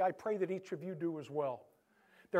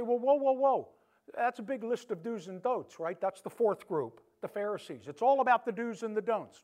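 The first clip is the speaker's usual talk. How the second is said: high, loud mock protest of a doubter; objecting listener; 4 semitones higher, 6 dB louder than usual. Pastor voicing a doubter protesting about rules, high and loud. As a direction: play the doubter throwing up his hands. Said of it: "You say".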